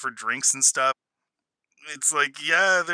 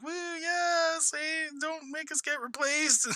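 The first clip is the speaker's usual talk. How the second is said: cheering droids; 12 semitones higher, 9 dB quieter, slower than usual. Retold or imitated. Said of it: "being like".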